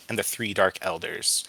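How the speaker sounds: ordinary delivery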